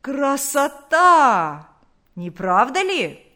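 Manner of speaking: loud, slow, drawn-out call; hoarse shout. A narrator's reading. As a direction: call it out to a neighbour, stretching the words. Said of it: "крикнул он хриплым голосом". (громкий протяжный окрик соседа)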